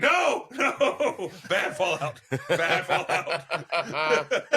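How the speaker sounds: high-pitched hammed-up wail through laughter; mock protest. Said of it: "I'm like".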